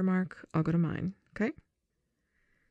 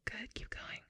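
soft whisper under the scene; whispered aside; 18 dB quieter than usual. Real, not acted. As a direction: whisper so the take keeps rolling. Directing a scene partner mid-take.

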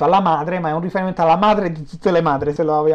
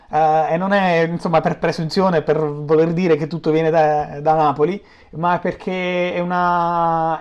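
normal talking voice

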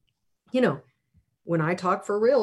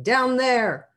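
high, raised call; shouting; 6 semitones higher, 5 dB louder than usual. A narrator's reading. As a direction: shout it as if pointing into the sea.

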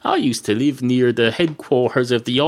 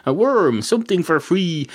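bird voice; 7 semitones higher than usual. Acted. Bird's thoughts voiced, high.

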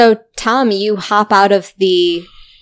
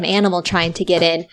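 usual speaking voice

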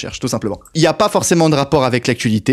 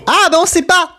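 much higher, exclaiming; animated protest; 13 semitones higher than usual. Real, not acted. Host telling players the answer is unclear, high animated protest. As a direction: cry it out in a high voice.

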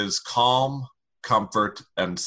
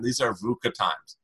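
normal talking voice

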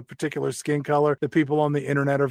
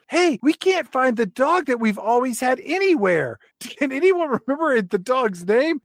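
put-on kid voice; 9 semitones higher than usual. [name] voiced, far and high.

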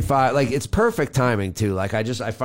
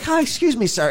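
much higher, sweet polite request to a stranger; overly polite plea; 10 semitones higher than usual. Retold or imitated. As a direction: play it nervous but extra polite. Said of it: "I'm freaking out and I'm like".

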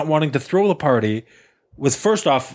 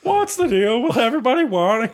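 high, laughing bad comedian impression; hammed-up observational comic, pitched up; Seinfeld; 9 semitones higher than usual. Friend riffing on party guests as a bad comedian impression. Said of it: "not even a good Seinfeld impression".

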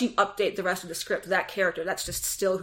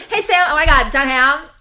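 excited gushing; 5 semitones higher, 10 dB louder than usual. Shown as a demonstration. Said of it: "that's the first thing you say".

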